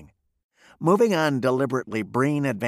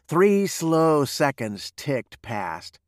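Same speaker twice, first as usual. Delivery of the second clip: usual speaking voice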